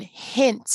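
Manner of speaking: "hint" with its short i held a little; slightly stretched vowel. Shown as a demonstration. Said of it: "It stretches out a little bit".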